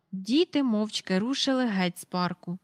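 ordinary delivery

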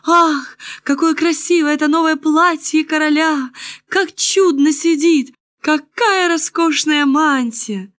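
high-pitched gushing praise; lifted, delighted oohing; admiring crowd. A narrator's reading. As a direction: exclaim in rapture like an awed onlooker.

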